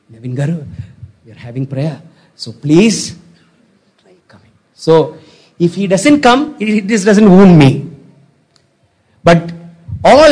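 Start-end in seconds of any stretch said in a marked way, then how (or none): 0.1-4.5 very soft, hushed polite request; timid, careful plea, trailing into a whisper; tentative invitation. Acted. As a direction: ask gingerly, fading almost to a whisper.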